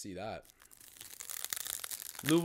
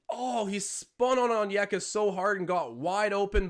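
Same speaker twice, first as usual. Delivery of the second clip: excited; 7 semitones higher, 6 dB louder, slower than usual. Real, not acted. Calling a big play live.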